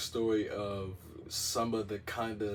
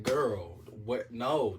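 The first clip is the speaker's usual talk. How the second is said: ordinary talk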